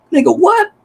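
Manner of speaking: high, disbelieving mock retort; incredulous local. An Irish local eyeing the fake Irishman, high incredulous mock retort. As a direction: play the baffled local staring side-eyed. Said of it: "look at you side-eyed like".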